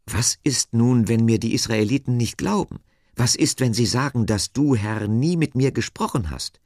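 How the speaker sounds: high, emphatic worried questioning; Moses, worried objection. A narrator's reading. Moses fears the Israelites won't believe him, worried.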